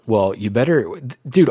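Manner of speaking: ordinary delivery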